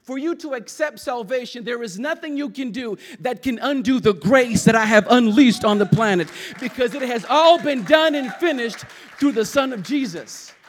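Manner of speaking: loud, rising preacher's proclamation; preaching at full force. Acted. Pastor voicing God to the church, booming preaching. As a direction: boom it out over the congregation.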